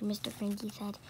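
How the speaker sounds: ordinary delivery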